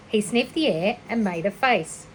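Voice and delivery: plain reading voice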